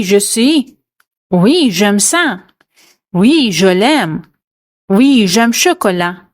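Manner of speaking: ordinary talk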